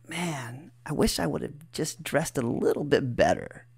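hushed, low inner voice; soft aside to oneself; thinking to oneself. Retold or imitated. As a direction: think it aloud, softly, as a private regret. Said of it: "I remember thinking to myself".

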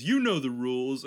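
a hint of snide parroting; mock rule-quoting. Mocking the rule guy again.

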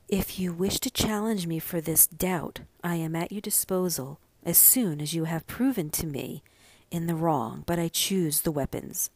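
ordinary delivery

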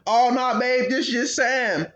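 high, slow innocent voice; a lightly voiced innocent boyfriend; mock innocent brush-off. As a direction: play it off sweetly. He brushes off the girlfriend.

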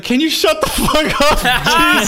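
slow, high, loud comic outrage; exasperated mock yell. Acting out snapping at a loud brother, exasperated yell. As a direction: yell to silence an embarrassing relative.

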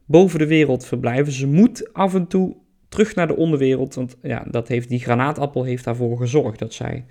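ordinary talk, nothing marked

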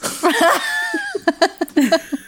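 high burst of laughter; high-pitched, amused cackling. Real, not acted. Laughing at own absurd argument.

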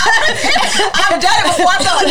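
high, slow laughing admission; laughing through words. Real, not acted. Laughing as she admits she has done it. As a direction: giggle it out, drawn way out. Joking about elevator antics.

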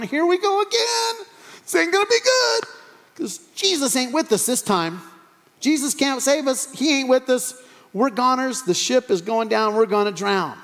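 high-pitched comic despair, easing down gradually; hammed-up wailing complaint; mock panic. Disciples swamped by waves without Jesus, mock panic.

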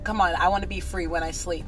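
usual speaking voice

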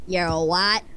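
lightly voiced, low puzzled question; baffled cartoon reply. Cartoon character answering a pianist, baffled. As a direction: drop low for the puzzled straight man.

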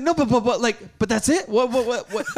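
high, loud stammering protest; frantic disbelief. Retold or imitated. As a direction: imitate someone refusing to accept it. Imitating Julian refusing the loss.

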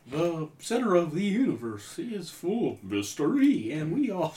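hushed documentary narrator impression; mock cosmic lecture; Carl Sagan. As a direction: softly intone like a science host. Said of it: "You sound like a fucking Carl Sagan".

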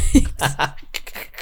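breathless unvoiced wheeze laugh; horse-calling laugh. Real, not acted. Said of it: "like you're trying to call a horse".

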